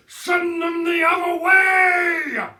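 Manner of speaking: bellowing. A narrator's reading. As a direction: roar at the fool near his car. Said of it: "Mister Hazel bellowed".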